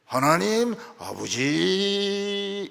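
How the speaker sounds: 하나님 아버지 stretched very slowly; drawn out. Shown as a demonstration. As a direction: drag out 하나님 아버지 reverently. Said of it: "이거 끌어줘야 됩니다".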